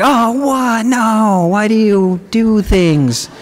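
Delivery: high, shocked mock outrage at the taste; shocked disgust. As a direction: recoil from the first bite. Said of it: "and I was like".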